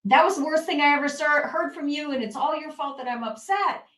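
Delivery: loud, high, fast accusation; the reaction she tells parents to avoid, shouted fast; angry blaming outburst. Acted. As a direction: lash out and blame them. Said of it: "And you don't say".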